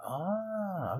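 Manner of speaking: long, high-pitched "ah"; intrigued realization. Retold or imitated. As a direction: draw out a high, knowing ah. His reaction to Liv's anger.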